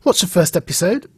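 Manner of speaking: ordinary delivery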